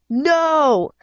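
high, drawn-out angry cry; restrained scream, stretched and high; storybook scream. A narrator's reading. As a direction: cry out in mounting anger. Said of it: "I screamed".

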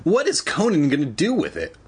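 usual speaking voice

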